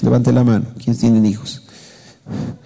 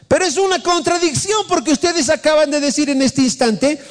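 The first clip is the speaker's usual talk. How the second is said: high-pitched, urgent preacher's delivery; impassioned preaching; 13 semitones higher than usual. Real, not acted. Pastor confronting the congregation's contradiction, impassioned preaching. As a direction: press the argument home at a high pitch.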